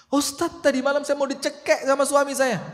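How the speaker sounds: high, pleading complaint to the preacher; a wife pleading with her ustadz in a high voice; complaining wife. Acted. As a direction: whine it up high like a fed-up wife.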